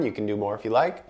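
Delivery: usual speaking voice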